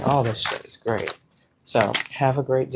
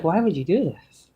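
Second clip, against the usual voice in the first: pleading with God; 6 semitones higher than usual. Retold or imitated. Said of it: "I'd be like".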